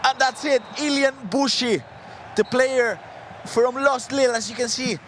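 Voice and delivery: high, thrilled play-by-play; excited call. Real, not acted. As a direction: call the win with joy. Caster calling the winning moment.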